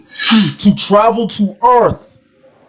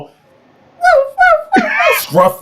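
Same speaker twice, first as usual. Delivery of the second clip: high puppy woofs; small high-pitched mock barking; imitated puppy; slower than usual. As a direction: yap like a little dog, pitched way up. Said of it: "you don't know what a puppy is?".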